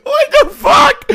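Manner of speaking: high screaming laugh-cry; laughing shout. Real, not acted. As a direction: yell it, half laughing. Shocked at ruined shirt.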